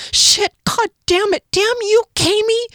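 loud, high panicked cursing; near panic. A narrator's reading. Kier in total darkness cursing Kami, panicked. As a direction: curses tumbling out in terror. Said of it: "pushed from behind by near panic".

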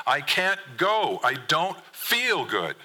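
slow, high-pitched protest; yelled back. A narrator's reading. Son yells at dad in the hall, upset and insistent. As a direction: stretch out each word in an upset yell. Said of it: "you yell back at me".

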